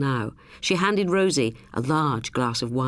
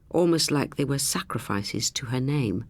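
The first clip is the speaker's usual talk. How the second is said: ordinary delivery, nothing marked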